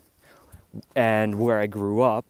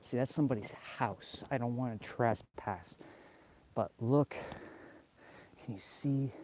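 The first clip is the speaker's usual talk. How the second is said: hushed; 11 dB quieter than usual. Real, not acted. Vlogger murmurs near somebody's house, softer and quick. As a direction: keep it down, you're next to a house.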